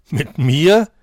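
incredulous Mit mir with a rising tune; a mocking, astonished question; scoffing surprise. A narrator's reading. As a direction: Mit mir asked in loud disbelief. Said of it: "spottete der Hase erstaunt".

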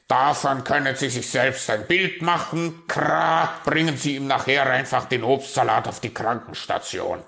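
loud raven voice with a caw; brusque raven. Acted. Raven sending the magpie to the sickroom, brusque. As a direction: send her off loudly, croaking midway.

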